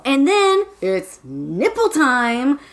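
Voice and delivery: normal talking voice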